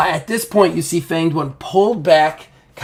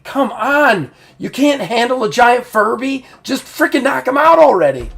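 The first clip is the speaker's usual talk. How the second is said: high, loud, impatient heckling; exasperated goading; 10 semitones higher, 5 dB louder than usual. Acted. Game master as Ocelot egging on the big guy, exasperated. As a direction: berate a teammate in acted exasperation. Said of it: "She's like".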